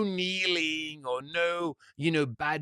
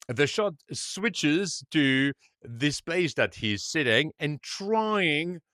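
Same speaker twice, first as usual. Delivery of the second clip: normal talking voice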